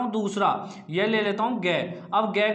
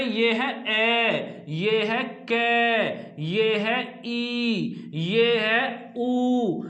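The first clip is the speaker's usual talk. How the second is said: very slow list, each closing sound held; slow, drawn-out option reading; much slower than usual. Shown as a demonstration. Teacher laying out four exam options, slow and drawn-out. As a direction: stretch ए, के, ई, ऊ out long.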